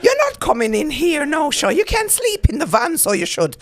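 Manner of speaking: high, loud scolding Irish mother at the door; mock Irish mammy. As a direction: nag like an Irish mammy waiting up.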